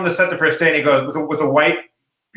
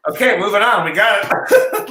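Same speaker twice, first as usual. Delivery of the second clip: resigned director; 4 semitones higher, 4 dB louder, slower than usual. Retold or imitated. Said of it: "eventually the director's like".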